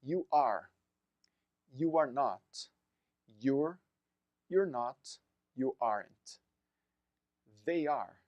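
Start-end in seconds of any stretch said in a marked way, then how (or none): none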